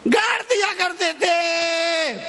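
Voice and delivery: high, slow declamation; calling out. Real, not acted. Preacher explaining old buried savings, calling out. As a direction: draw it out high to the whole hall. Preacher calls out the key words.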